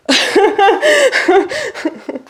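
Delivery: loud, high burst of real laughter. Real, not acted. Laughing at her own Friends joke, a high loud cackle.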